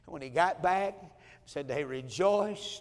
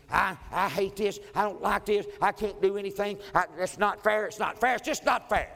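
higher and louder, a mock-sulky complaint; whiny teen tantrum; 5 semitones higher, 5 dB louder than usual. Daughter complaining about being a pastor's kid, loud and whiny. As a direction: whine it like a teenager stamping her foot. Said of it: "he was just having her a time".

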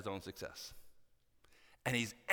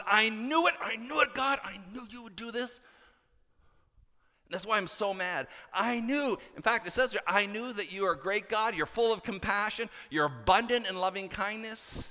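higher, louder indignant griping at God; Jonah's angry complaint; 9 semitones higher, 4 dB louder than usual. Retold or imitated. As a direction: complain to God, fed up and resentful. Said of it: "Jonah's response is".

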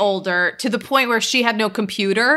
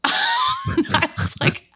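laughing through the words; much slower than usual. Real, not acted. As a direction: breaks up with laughter.

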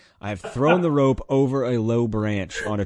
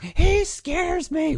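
put-on much higher, slow child yelling in fear; scared yell; 5 dB louder, much slower than usual. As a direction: stretch it out like a frightened kid.

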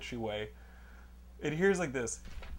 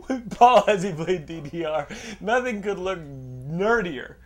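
ordinary talk, nothing marked

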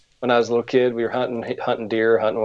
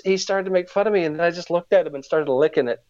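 normal talking voice

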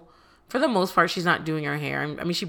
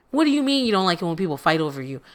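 usual speaking voice

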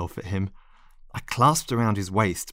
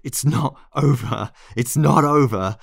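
louder, higher, insistent repetition; spoken out loud; 5 semitones higher, 7 dB louder than usual. A narrator's reading. Narrator lurches after Lionel, declaring it out loud. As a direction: declare it forcefully, building on the repeat. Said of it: "that I spoke out loud".

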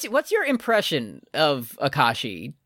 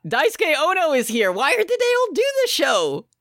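high-pitched astonished exclaiming; shocked disbelief; 13 semitones higher than usual. Real, not acted. Host finds famous actors in the cast list, high-pitched disbelief. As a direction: exclaim each find in high, incredulous amazement.

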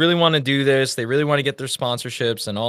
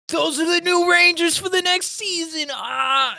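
excited gasp; 13 semitones higher than usual. Retold or imitated. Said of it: "my wife goes".

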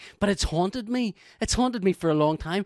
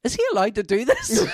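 laughing question; 6 semitones higher, 6 dB louder than usual. Retold or imitated. Annette whispering at concert.

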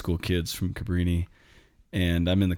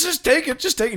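much higher, louder hammed-up begging; pushy plea; 6 dB louder than usual. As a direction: shove it into his hands. Guy pushing the boombox on him.

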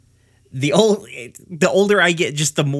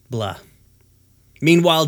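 usual speaking voice

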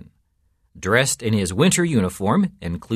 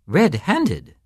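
high questioning echo from a startled priest; dismayed echo; 9 semitones higher, 3 dB louder than usual. A narrator's reading. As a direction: repeat RED-HANDED pitched up, laughter gone. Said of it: "The priest stopped laughing".

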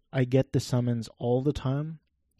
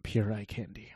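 whispering; 4 semitones lower, 7 dB quieter than usual. Real, not acted. Muttered aside about actress.

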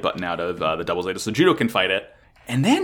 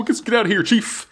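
urgent excited shout; 12 semitones higher, faster than usual. Retold or imitated. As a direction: yell to flee in a hurry. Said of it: "they're like".

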